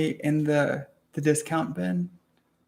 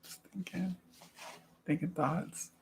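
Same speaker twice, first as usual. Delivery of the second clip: whispering; 3 semitones higher, 13 dB quieter than usual. Real, not acted. Tired musing to self.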